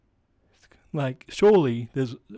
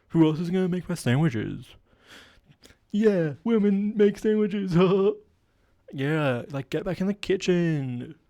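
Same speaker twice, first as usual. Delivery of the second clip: higher, slower, smug bro voice; hammed-up chauvinist bro, pitched up; misogynist bro character; 5 semitones higher than usual. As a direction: drawl it like a clueless alpha male. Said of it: "playing a misogynistic character".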